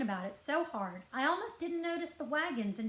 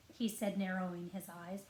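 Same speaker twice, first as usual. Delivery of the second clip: ordinary delivery, nothing marked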